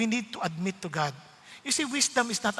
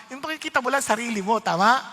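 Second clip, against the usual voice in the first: usual speaking voice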